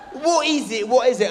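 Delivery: put-on high, loud child's demand; pestering kid. Son keeps asking dad, high loud kid voice. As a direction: nag like an eager boy.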